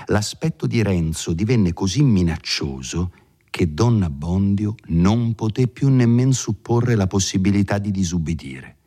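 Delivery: usual speaking voice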